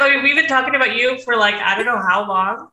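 ordinary talk

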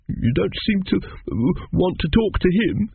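put-on high, halting child's voice; timid small boy. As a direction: hesitate mid-question like a shy little boy. Arthur haltingly questions Teppic.

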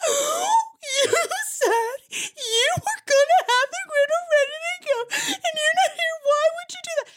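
very high, loud hammed-up crying wail; theatrical squealing cry of complaint; mock sobbing. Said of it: "and I'm sobbing".

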